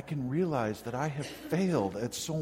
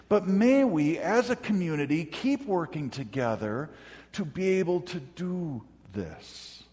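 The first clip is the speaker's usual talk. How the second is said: high, loud preaching build that slows and eases at the end; preaching at full force; 6 semitones higher, 5 dB louder than usual. Acted. Pastor urging his congregation to live together, preaching at full force.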